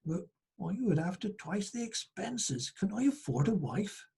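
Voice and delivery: lightly voiced peddler doubting the cost; peddler weighing it up. A narrator's reading. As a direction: think it over aloud, quietly. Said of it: "he thought".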